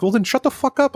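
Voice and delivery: high-pitched, exasperated comeback; retold angry outburst. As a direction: re-enact going off on him, pitch jumping up. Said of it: "I just went off on him".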